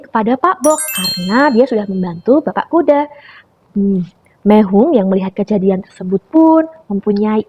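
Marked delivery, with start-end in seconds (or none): none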